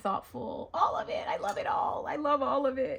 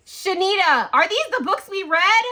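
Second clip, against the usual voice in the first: high, loud amazed exclaim; excited; 10 semitones higher, 10 dB louder than usual. Real, not acted. Finding miniatures of book club books, loud and thrilled. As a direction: call out in thrilled disbelief.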